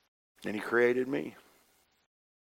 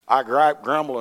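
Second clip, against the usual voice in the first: usual speaking voice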